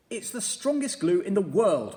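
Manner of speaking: high, loud, eager commanding creature; a bossy little beast giving orders; Mugglewump voice. A narrator's reading. Mugglewump directing the birds, loud and high. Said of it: "Mugglewump replied".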